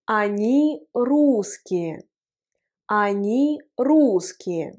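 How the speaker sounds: very slow, clear Они русские; slow teaching demonstration; slow model phrase. Shown as a demonstration. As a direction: spell the phrase out slowly for learners.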